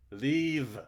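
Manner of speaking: loud, high command; a furious demand; angry order. Acted. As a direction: shout at him to go. Ordering him out.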